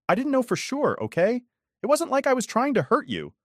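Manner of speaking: ex-wife pleading innocence, high and whiny; defensive Catherine. A narrator's reading. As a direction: play her as a shrill excuse-maker.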